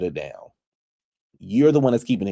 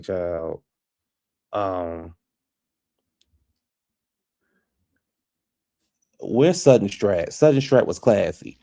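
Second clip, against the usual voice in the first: imitating Erica; 2 semitones lower, much slower than usual. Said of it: "Erica was like".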